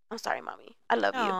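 put-on hushed, whispered little-boy sorry; lightly voiced child, barely whispered; small child's apology. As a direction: breathe it softly like a young son making up. Mother voices her son apologizing.

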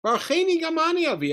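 put-on high-pitched childish plea; pouting kid. Esav begging his father for a blessing, like a pouting kid. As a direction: put on a pouty child's wail. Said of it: "he's like a kid pouting".

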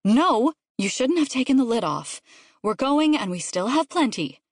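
mildly exasperated parent; scolding mother. A narrator's reading. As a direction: put your foot down with the kid.

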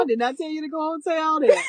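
laughing shout. Real, not acted. Laughing protest at sister.

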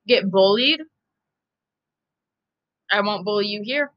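ordinary talk

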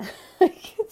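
high, loud laugh breaking out; sudden bright laugh; burst of laughter. Real, not acted. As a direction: laugh at your own bluntness. Laughs at own urging.

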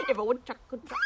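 laughingly mimicking an Indian accent; mock Indian accent. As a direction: imitate an Indian lilt while laughing. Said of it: "You sounded Indian towards the end".

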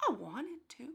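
soft, breathy confession; whisper. Real, not acted. Telling her friend she wanted to hit him, whispered. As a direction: admit it under your breath.

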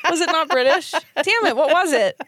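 high-pitched, incredulous question through laughter; laughing protest. Real, not acted. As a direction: protest while cracking up. Laughing over own failed accent.

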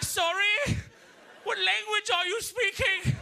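high, slow British schoolboy; drawn-out, high-pitched British politeness; British accent. Retold or imitated. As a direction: play a baffled, proper British kid. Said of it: "I had a hint of a British accent".

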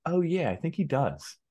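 high, drawn-out mock-innocent reply; feigned casualness. Son dodging mom's question about Dan's podcast, feigned casualness. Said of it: "And I was like this".

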